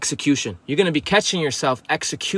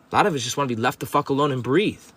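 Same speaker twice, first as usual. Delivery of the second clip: ordinary talk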